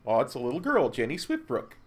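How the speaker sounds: ordinary talk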